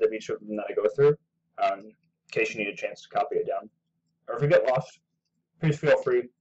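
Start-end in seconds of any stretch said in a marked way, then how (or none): 4.3-6.3 talking while chewing popcorn; munching popcorn. Real, not acted. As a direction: mouth full of popcorn. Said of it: "sorry, I'm eating popcorn".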